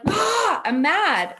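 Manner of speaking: loud, high frustrated growl of a mad parent; a hammed-up burst of anger at a toddler; mock angry outburst. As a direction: blurt it out like a fed-up parent. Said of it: "No, I'm like".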